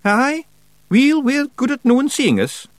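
high, quick Scottish pixie voice; cheeky little Scots warrior; Scottish Feegle voice. A narrator's reading. Rob Anybody, smiling.

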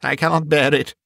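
mildly exclaimed, anguished plea; exclaiming. A narrator's reading. Scrooge can stand the visions no longer, restrained exclamation. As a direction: cry out with restrained distress. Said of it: "Scrooge exclaimed".

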